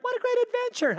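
very high, gleeful exclamation; hammed-up high-pitched enthusiasm; mock elation. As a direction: imitate a thrill-seeker's delight. Friend's delight acted against his dismay.

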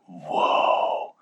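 low, hushed, breathy whoa; awed child. Retold or imitated. Son hearing how Satan is imprisoned, hushed awe. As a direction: imitate a little boy's quiet amazement.

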